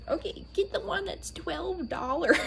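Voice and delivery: high, eager mom voice, fast; imitating mom. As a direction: insist like an excited mother. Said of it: "She's like".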